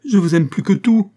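high, loud, frantic insistence; distraught cry. A narrator's reading. Monique protests her love to madame, distraught cry. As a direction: cry out desperate love at full voice. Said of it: "cria Monique éperdue".

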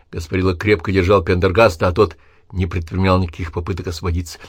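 ordinary delivery, nothing marked

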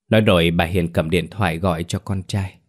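ordinary delivery

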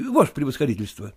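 usual speaking voice

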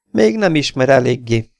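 mock the wounded man; taunting. A narrator's reading. Phantom to Stanley he has just shot, taunting. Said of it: "gúnyolódott a fantom".

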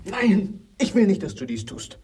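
hohes, erschrockenes Nein; entsetzt. Acted. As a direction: schrei das Nein entsetzt heraus. Wehrt entsetzt ab. (entsetztes Abwehren)

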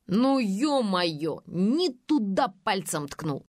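slow, dismayed groan of a line; upset. A narrator's reading. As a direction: drag it out, annoyed at yourself. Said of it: "расстроился Фёдор". (расстроенно)

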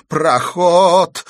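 high, loud desperate plea; pleading. A narrator's reading. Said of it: "умоляющим тоном произнёс он". (умоляющим тоном)

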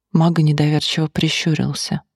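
plain reading voice

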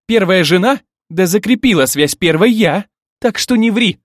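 loud, high accusing retort; indignant challenge. A narrator's reading. Bride disputing who was first wife, loud indignation. Rival bride jumps into the quarrel.

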